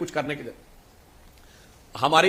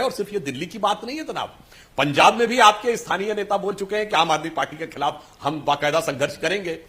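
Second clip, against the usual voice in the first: ordinary talk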